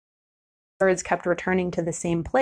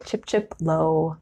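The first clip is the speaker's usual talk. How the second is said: chip chip dropped low and slower; 2 semitones lower, slower than usual. Shown as a demonstration. Recalling the sedge wren mnemonic for viewers, low slower chip. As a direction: let the chips sink low and slow. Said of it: "remember the sedge wren was chip".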